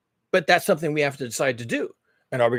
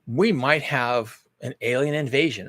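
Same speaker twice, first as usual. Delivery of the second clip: normal talking voice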